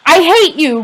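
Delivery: high, loud scream of hate. A narrator's reading. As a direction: scream it at him. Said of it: "Rowdy screamed".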